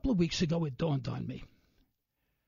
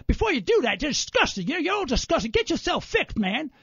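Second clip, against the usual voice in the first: loud, higher, ranting mock abuse; louder, mock-disgusted rant at a radio host; angry caller; 5 semitones higher, 4 dB louder than usual. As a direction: ham up a bigoted caller's tirade. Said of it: "I had one guy tell me".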